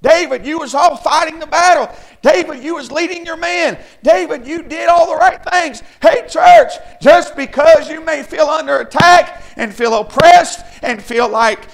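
rousing shouted encouragement to the church; full-force preaching. Real, not acted. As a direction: preach it at the top of your voice.

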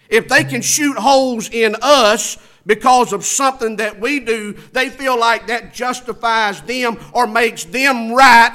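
high, loud, driving sermon voice; full-force preaching. Real, not acted. Preacher urging the flock to be careful, full-force preaching. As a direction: preach hard, stretching the key words.

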